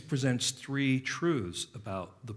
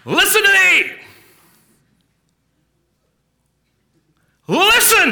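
much higher, loud shout of "Listen to me!"; pastor's shout; 15 dB louder than usual. Retold or imitated. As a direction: bellow it like a fiery old preacher. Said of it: "My pastor growing up would say this".

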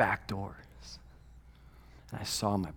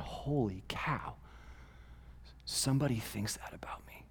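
awed whisper; 14 dB quieter than usual. Retold or imitated. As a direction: breathe it out in disbelief. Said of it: "He's going".

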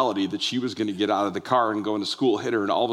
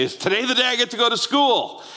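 put-on higher eager child's voice; eager little girl; 10 semitones higher than usual. Father retelling his daughter's morning question, high childlike eagerness. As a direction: ask like an excited kindergartner. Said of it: "she'd say".

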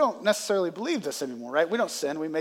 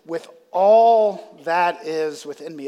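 slow, louder stress on all; emphatic quote; 6 dB louder, slower than usual. Retold or imitated. Pastor quotes David to the church, slow and emphatic. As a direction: lean hard into "all". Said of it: "He says".

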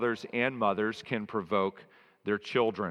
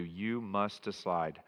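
ordinary talk

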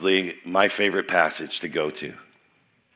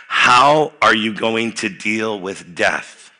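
slow pointed question; 4 dB louder, much slower than usual. Real, not acted.

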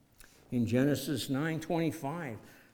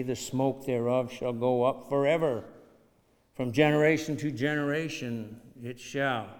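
scripture reading; 6 dB louder than usual. Acted. Preacher reading Isaiah.

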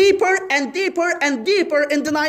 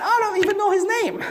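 fast high denial; 7 semitones higher, faster than usual. Retold or imitated. Preacher voicing Peter's frantic denial, fast and high. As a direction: rush it out high and quick, a mock panicked Peter.

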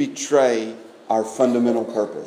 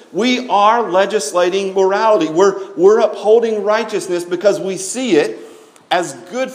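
raised, driving pulpit voice; fervent preaching; 5 semitones higher than usual. Acted. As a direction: preach it hard to the congregation. Preaching at full force.

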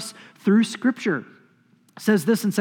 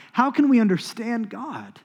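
normal talking voice

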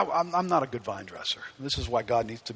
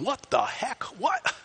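high-pitched, baffled disbelief; mock freak-out; 10 semitones higher, slower than usual. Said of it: "freaking out, going".

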